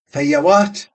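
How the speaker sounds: a sniffy fogey voice, lightly done; snuffy fogey's tone. A narrator's reading. Barmaid mimicking the old fogey, lightly snuffy. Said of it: "in snuffy fogies tone".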